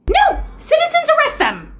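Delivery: very high, squealed order; shrieking insistence. Acted. Woman ordering police to arrest auditors, shrieking. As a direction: screech in acted outrage.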